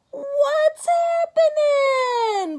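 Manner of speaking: loud, high, slow bellow; blaring greeting. Acted. Tacky blares his greeting at the hunters, loud and high. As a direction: stretch it out and blare it. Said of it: "blared Tacky".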